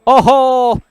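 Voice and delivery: sudden loud ooh at the shot; excited exclamation. Real, not acted. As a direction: burst out at the big hit. (उत्साहित चीख)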